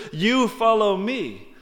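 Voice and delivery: slow, with the stress on You; a pointed You follow me, slightly slowed; emphatic command. Acted. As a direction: lean hard on You, then ease into follow me. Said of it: "Jesus is extremely emphatic here".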